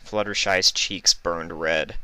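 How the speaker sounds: usual speaking voice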